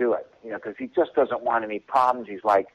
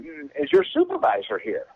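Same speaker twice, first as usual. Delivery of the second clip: mock sweet request. Retold request, sweet put-on tone.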